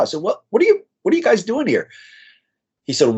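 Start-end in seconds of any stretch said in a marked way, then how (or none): 0.2-1.9 much higher, stumbling astonished question. Retold or imitated. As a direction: ask in retold disbelief.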